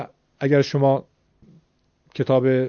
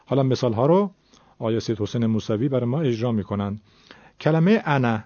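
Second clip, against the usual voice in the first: usual speaking voice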